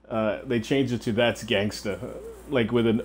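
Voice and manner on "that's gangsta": trailer voiceover. Retold or imitated. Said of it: "a voiceover".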